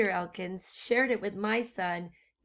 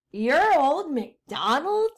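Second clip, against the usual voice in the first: suspicious witch; 4 semitones higher, 6 dB louder than usual. Acted. Witch questions the disguised farmer.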